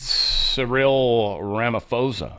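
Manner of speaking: ordinary talk, nothing marked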